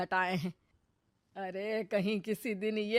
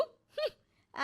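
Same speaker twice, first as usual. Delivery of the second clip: long, high, soft disdainful hum; long scoffing hum; 6 dB quieter, much slower than usual. Acted. Mother-in-law scoffing at her daughter-in-law, disdainful hum. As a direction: drag out a sneering hmm.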